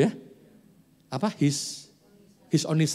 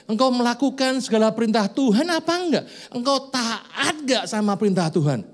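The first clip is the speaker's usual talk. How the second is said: high, loud, pressing sermon questions; forceful preaching; 9 semitones higher, 4 dB louder than usual. Acted. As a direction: challenge the congregation hard. (berkhotbah dengan berapi-api)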